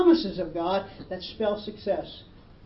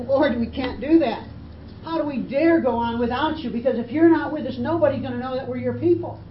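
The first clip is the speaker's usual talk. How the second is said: urgent plea, higher and louder; Moses pleading; 5 semitones higher, 5 dB louder than usual. Retold or imitated. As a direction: plead earnestly with rising force. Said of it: "he pleads with God".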